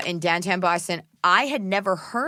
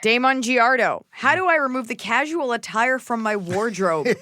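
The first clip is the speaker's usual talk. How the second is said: higher, hammed-up reading of a letter; dramatic question read-out; 4 semitones higher than usual. Co-host reading a listener's wardrobe question aloud, hammed up. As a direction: read it out with big emphatic swoops.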